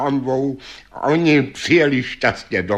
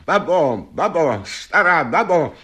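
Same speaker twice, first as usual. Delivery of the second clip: high-pitched taunting call; 7 semitones higher than usual. A narrator's reading. Janko shouting to the witch under her window, calling out. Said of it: "zavolá".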